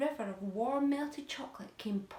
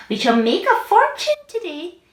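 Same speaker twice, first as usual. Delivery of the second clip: greedy aunt voice; 9 semitones higher, 19 dB louder than usual. Acted.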